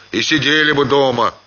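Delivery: суровый старик, быстро и выше; строго отчитывает барина, скороговоркой; сурово. A narrator's reading. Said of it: "проговорил Семён сурово". (старый Семён сурово отчитывает Ханова, быстро)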